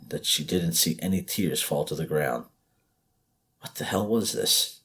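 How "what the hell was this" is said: usual speaking voice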